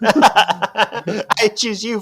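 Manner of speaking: high, slow, drawn-out call; mock summoning cry. Co-host extending the Pokémon joke, mock summoning cry. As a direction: summon it grandly, half laughing.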